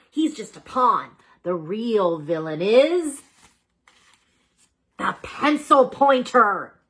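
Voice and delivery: slow, with a pause before the name; dramatic reveal. A narrator's reading. Boss Bunny revealing the villain to the pets, slow and dramatic. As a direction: build suspense, then drop the name.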